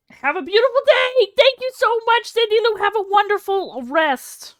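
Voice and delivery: high, loud, sugary thank-you voice; sing-song thanks. Acted. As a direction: gush like a greeter.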